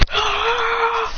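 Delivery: long high strained scream; power-up scream. Acted. Adrian unleashing the fire tornado, screaming.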